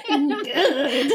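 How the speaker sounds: "Good." dissolving into laughter; laughing "Good.". Real, not acted. Cohost reacts to creepy line, laughing. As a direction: let "Good." break into laughing. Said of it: "that was so creepy".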